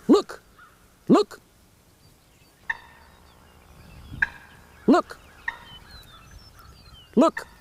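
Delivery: very high, loud sharp "Look!" bursts; loud danger putt. Shown as a demonstration. A turkey warning the flock of danger, loud alarm. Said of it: "a cluck that's given very loud".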